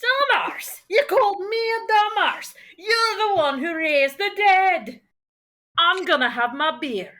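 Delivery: high, offended character voice; affronted drinker, settling down at the end; indignant Crouton. Pretend. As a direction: repeat the insult in mock outrage. GM plays insulted Crouton.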